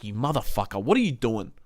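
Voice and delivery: low, quiet retold seething. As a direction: mutter it low, recalling your fury. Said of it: "like, seething at T D K".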